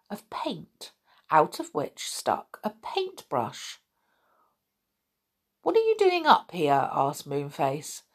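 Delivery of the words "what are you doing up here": high, curious little voice; Moonface's high voice. A narrator's reading. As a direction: ask with bright surprise. Moonface asks the goblin.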